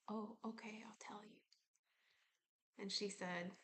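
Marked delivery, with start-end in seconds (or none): 0.1-1.4 soft secretive whisper; body's whisper. Pretend. Body voice confides an answer.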